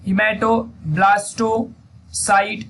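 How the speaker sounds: hematoblastocite broken into slow separate syllables; teacher spelling out a hard term very slowly; very slow first take. Shown as a demonstration. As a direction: crawl through hematoblastocite for dictation. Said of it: "तो उसका नाम है hemato".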